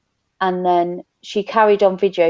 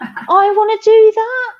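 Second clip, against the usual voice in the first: high, loud, slow gush of excitement; mock excited; 12 semitones higher, 6 dB louder, slower than usual. Seeing Simone Biles do renegade rows, high excited gush. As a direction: gush like a kid who wants a new toy.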